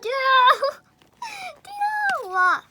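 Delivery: high, loud squeal with a laugh; excited squeal. Real, not acted. Fan finding a Dino photo, squealing his name. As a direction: shriek the name, laughing with joy.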